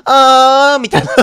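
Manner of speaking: mock old-guy groan with a laugh; laughing impression of a middle-aged man's groan; imitating a middle-aged man's moan. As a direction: do a goofy imitation of a man moaning. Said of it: "おっさんの喘ぎ声みたいなの聞こえた".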